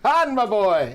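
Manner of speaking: loud, high-pitched jovial impression; big hammed-up movie crime boss greeting; Jabba the Hutt. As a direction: boom out an over-hearty greeting. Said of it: "pre-digital replacement Jabba the Hutt".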